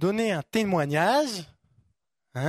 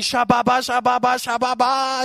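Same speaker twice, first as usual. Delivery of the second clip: lightly mocking babble, a bit louder; mock tongues; 6 semitones higher, 6 dB louder than usual. Preacher mocking empty tongues, light babbling chant. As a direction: toss off the nonsense like a fake tongues prayer. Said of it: "C'est pas juste le Saint-Esprit".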